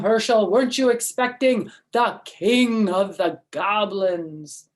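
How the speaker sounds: loud, menacing monster voice; goblin king's voice. Acted. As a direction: loom over him like a cracking-bone monster. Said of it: "a voice that sounded like the cracking of bones".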